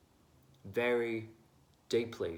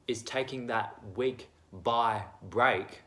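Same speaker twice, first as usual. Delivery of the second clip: ordinary talk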